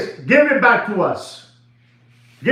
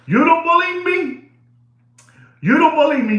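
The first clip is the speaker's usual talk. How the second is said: challenging; 7 semitones higher than usual. Real, not acted. Challenging the viewers.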